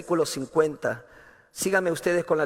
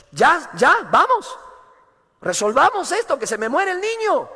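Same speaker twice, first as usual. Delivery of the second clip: frantic, much higher and louder cry to hurry; desperate father; 8 semitones higher, 3 dB louder than usual. Retold or imitated. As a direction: beg him to hurry, the child is dying. Desperate father demanding Jesus.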